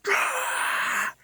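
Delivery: high unvoiced shriek; screaming at the campfire; retold scream. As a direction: let out a sudden cry. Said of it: "I just fucking screamed".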